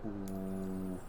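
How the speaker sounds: ordinary talk, nothing marked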